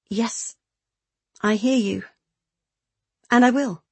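firm, careful assent in short phrases; trying to sound solid. A narrator's reading. Beth promising Kaylee to give up, as solidly as possible. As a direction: agree solidly, lifting on the promise. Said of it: "her voice as solid as she could make it".

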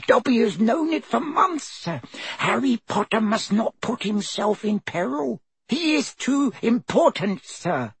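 high squeaky elf voice, humble and anxious; a trembling servant elf pleading; house elf Dobby. A narrator's reading. House elf Dobby explains his lot to Harry, high squeaky elf voice.

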